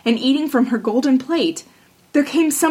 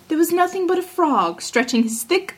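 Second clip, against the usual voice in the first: ordinary delivery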